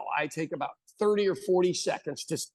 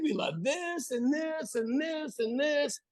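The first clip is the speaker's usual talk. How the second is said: high, slow, gushing voice, much higher; gushing childhood nostalgia; 10 semitones higher, 3 dB quieter, slower than usual. Acted. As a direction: swoon over the candy like a kid. Comedian acting out joke setup.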